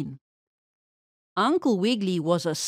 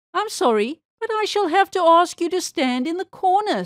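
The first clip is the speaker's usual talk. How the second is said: Lady Mouse teacher; 7 semitones higher, 3 dB louder than usual. A narrator's reading. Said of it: "said the Lady Mouse".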